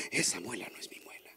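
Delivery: low unvoiced whisper; whispered mantra. Wife whispering the mantra under hypnosis. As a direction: breathe the words as a secret.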